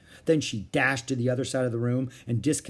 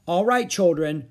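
louder, higher, firm; 6 semitones higher, 6 dB louder than usual. A narrator's reading. Mother addressing the children, firmly. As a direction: take charge of the kids. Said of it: "Mother spoke firmly".

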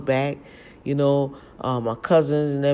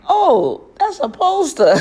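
high, loud embarrassed realization; the moment it dawns you were fooled; retold embarrassed realization, much higher and louder; sheepish realization; 10 dB louder, slower than usual. Realizes it's only a poster.

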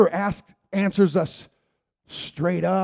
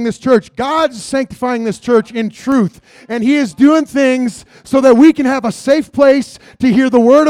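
louder, higher, impassioned preaching prayer; fervent prayer; 5 semitones higher, 9 dB louder than usual. Acted. Church member thanking God for her pastor, in fervent loud prayer. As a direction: pray out with rising fire. Prayer rises to full force.

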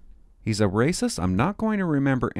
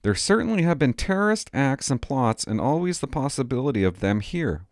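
ordinary talk, nothing marked

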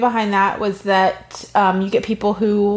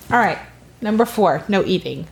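ordinary delivery

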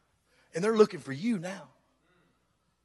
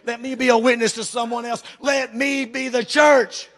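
preaching shout; 4 semitones higher, 6 dB louder than usual. Real, not acted. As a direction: build to a shouted plea.

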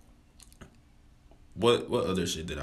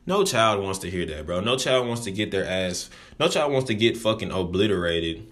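usual speaking voice

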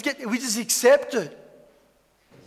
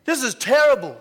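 upset farmers; 5 semitones higher than usual. Retold or imitated. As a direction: drag out the dismay like a ruined farmer. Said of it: "They're like".

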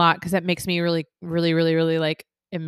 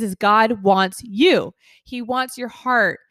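normal talking voice

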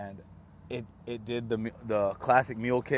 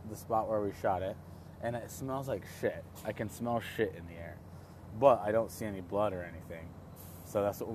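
ordinary delivery

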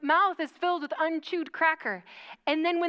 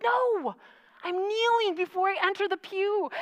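lightly voiced friend, higher, correcting her; a hint of the friend setting her straight, pitched up; friend's correction; 6 semitones higher than usual. Retelling how friend Stephanie corrected her, lightly voiced and higher. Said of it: "She's like".

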